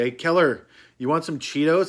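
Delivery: mock-eager offer to a passed-out friend; playful offer. Voices buddy offering Cheetos.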